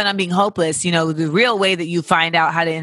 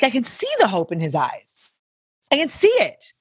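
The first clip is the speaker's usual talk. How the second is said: emphatic high; 5 semitones higher than usual. Real, not acted. Talking about dog's hopeful eyes.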